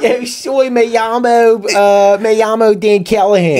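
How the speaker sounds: put-on high, loud Philly-accented Spanish; hammed-up Philadelphian butchering Spanish; Northeast Philly Spanish. Mocking the Philly guy in Cancun, thick Philly Spanish. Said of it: "But the Northeast still comes out".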